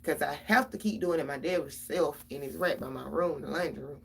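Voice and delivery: usual speaking voice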